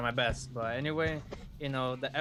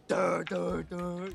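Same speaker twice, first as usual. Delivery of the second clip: sung guitar riff sounds; guitar noise; 4 semitones higher, much slower than usual. Retold or imitated. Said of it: "Not band as in".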